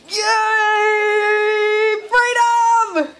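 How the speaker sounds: high, loud, drawn-out celebration; jubilant cheer. Real, not acted. Owner cheering her dog finally moving, jubilant cheer. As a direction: sing it out like a victory cry.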